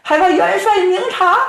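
high, fast, trembling plea; plead, shaking with fear; scared pleading. Acted. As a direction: cooks begging the marshal to see they're innocent. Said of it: "大家伙吓得哆里哆嗦呀".

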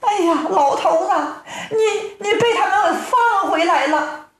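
high-pitched wailing wife voice; storyteller's acted weepy wife; tearful wife. A narrator's reading. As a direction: greet your husband in a high, fretful lament. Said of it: "正在屋中掉眼泪呢".

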